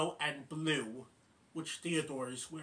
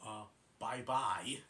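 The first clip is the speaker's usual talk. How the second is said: lower, slow, puzzled bye-bye; Great Prince's bye-bye; 6 semitones lower, 4 dB quieter, slower than usual. Retold or imitated. Great Prince answering Alvin's goodbye, low slow voice. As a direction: drop low and drag it out.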